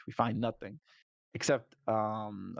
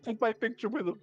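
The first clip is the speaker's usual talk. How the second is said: pitched way up, eager; high excited quote; 11 semitones higher than usual. Retold or imitated. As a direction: squeak it out like a giddy tourist. Player quoting own excited request.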